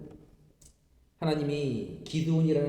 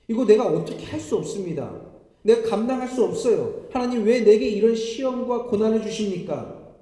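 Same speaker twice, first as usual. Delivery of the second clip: louder, rising protest to God, building; protesting cry; 4 semitones higher, 5 dB louder than usual. Retold or imitated. Pastor retelling his protest to God, crying out louder as if arguing. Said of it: "하나님께 따지듯이 이렇게 부르짖었습니다".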